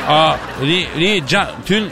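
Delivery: chopped-up syllables like a breaking phone signal; faked tunnel dropout. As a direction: fake losing reception, words cut into fragments. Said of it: "tünele girmişiz gibi numara çek kapat".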